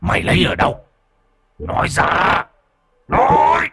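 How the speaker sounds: starts hushed, builds to a high harsh roar; interrogate, escalating to a furious shout; demon demanding answers. Acted. Demon questions the boy about his bracelet, escalating menace.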